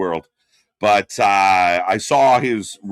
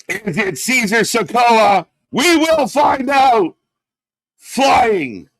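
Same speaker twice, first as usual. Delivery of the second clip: much higher, louder, slow shouted wrestling commentary; hyped play-by-play; 9 semitones higher, 5 dB louder, slower than usual. Acted. Calling Zilla Fatu's debut for viewers, loud hyped commentary. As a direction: call the debut like an arena announcer going wild.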